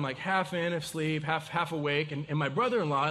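ordinary talk

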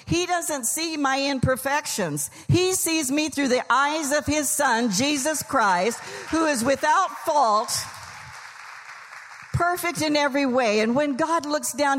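rising, fervent preaching. Acted. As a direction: lift the congregation with praise. Preaching builds toward climax.

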